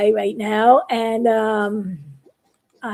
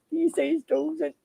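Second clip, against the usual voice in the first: muttered phrase; 2 semitones higher, 9 dB quieter than usual. Acted. Biggie mutters the phrase under his breath.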